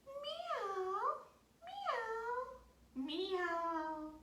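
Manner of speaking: high, stretched-out meows; cat meow. Pretend. Teacher meows to her belly button with children, high cat meow. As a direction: play the kitty, meowing high. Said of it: "we can meow together".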